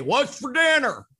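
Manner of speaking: high, loud mock demand for dinner; demanding husband. Husband walking in without saying hello to his wife, loud mock demand. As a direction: call it out like an inconsiderate husband.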